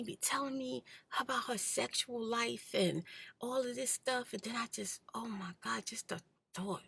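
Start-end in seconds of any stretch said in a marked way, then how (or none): none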